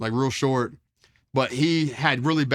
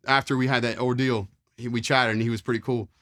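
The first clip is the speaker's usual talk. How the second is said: ordinary talk, nothing marked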